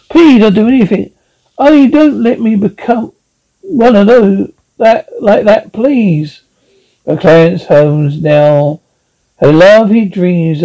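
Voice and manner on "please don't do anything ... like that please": desperate pleading. Acted. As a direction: plead for your life. Wife begs not to be turned.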